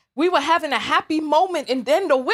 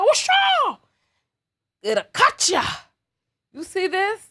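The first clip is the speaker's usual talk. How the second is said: squeaky mock taunt; 5 semitones higher than usual.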